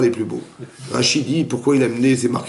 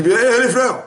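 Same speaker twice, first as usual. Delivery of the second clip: mock outraged protest; 10 semitones higher, slower than usual. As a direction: play the offended host calling out. Host voiced protesting in anecdote. (protestation indignée jouée, plus aiguë et plus forte)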